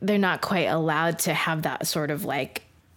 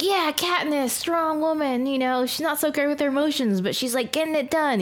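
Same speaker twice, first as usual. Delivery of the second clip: high, upbeat mock voice; mock enthusiastic; 9 semitones higher than usual. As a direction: gush about her, brightly. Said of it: "So we're like".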